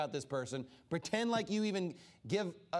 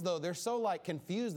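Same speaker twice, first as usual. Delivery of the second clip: ordinary delivery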